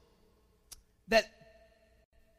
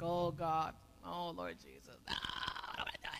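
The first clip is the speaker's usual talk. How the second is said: much lower, hushed acted plea to God; reluctant prayer; 6 semitones lower, 14 dB quieter than usual. Pastor acting out his dread at God's errand, hushed reluctant prayer. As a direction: pray it very softly, dragging your feet.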